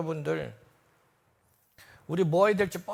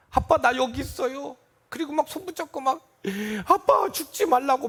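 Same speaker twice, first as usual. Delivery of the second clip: pleading daughter; 6 semitones higher than usual. Retold or imitated.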